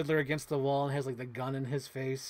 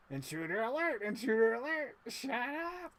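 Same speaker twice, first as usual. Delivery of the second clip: alarm shout; 9 semitones higher than usual. Pretend. Mimicking an alarm, toy bit.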